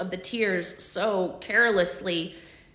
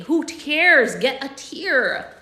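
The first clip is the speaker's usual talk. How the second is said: louder, slow hissed push; impatient urging; 5 semitones higher, 9 dB louder, much slower than usual. Acted. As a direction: hurry her, greedy. Connor urges impatiently.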